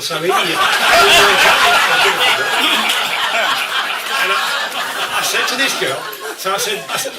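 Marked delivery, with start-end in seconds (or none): 0.4-4.3 long, loud cackle at his own punchline; loud laugh. Real, not acted. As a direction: crack up for real. Comic cracks up at punchline.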